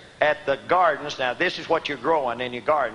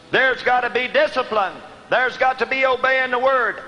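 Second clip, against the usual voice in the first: higher, emphatic preaching voice; raised preaching; 5 semitones higher than usual. Real, not acted. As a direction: preach it out to the congregation. Preaches the verse with force.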